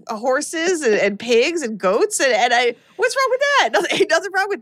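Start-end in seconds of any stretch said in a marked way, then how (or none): 3.0-4.6 mock offended. Real, not acted. Defending her farm upbringing.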